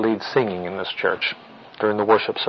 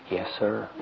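put-on small, hushed child's reply; soft voice; 9 dB quieter than usual. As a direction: answer meekly, very softly. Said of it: "in a real soft voice".

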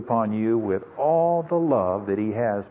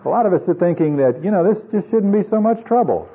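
high, louder exasperated complaint; mock complaint; 8 semitones higher, 5 dB louder than usual. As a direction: voice a naive grumble, pitched well up. Voices complaint of naive believers.

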